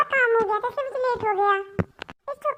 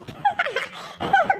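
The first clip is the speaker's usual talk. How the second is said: mocking laugh; 6 semitones higher than usual. Friend laughs at beaten friend.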